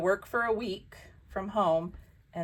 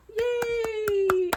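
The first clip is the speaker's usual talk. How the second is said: much higher bright yay; a quick sarcastic-ish little cheer. Real, not acted. Vlogger cheering about her new job, high yay.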